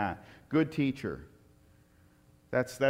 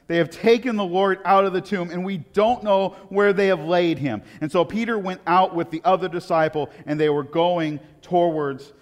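louder, higher scripture reading; 8 semitones higher, 10 dB louder than usual. Acted. The preacher reading John to the congregation, urgent and loud. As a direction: read the verse with urgency.

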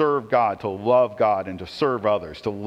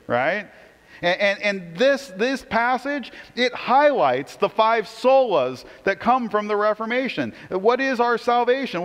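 louder, higher, rising preacher's call; fired-up preaching; 8 semitones higher, 5 dB louder than usual. Acted. Pastor to congregation on Reformation Day, louder, higher preaching. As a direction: proclaim each sola like a rallying cry.

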